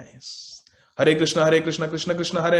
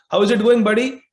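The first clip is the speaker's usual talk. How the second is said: higher, louder friendly check-in; cheery greeting; 6 semitones higher, 7 dB louder than usual. Acted. A friend calling out a cheery hello to the brahmachari. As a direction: call out brightly to a buddy.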